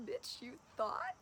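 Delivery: high, slow mock sass, drawn out; slow high mock-sassy jab; sassy retort. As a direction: tease her past self with a slow high jab. Sassy retort to past self.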